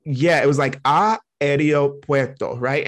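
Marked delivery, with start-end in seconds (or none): 0.8-2.6 "Ah, aeropuerto" drawn out slowly, syllable by syllable; slow careful aeropuerto. Shown as a demonstration. Showing the Duolingo word, slow and careful. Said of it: "I can do it slow".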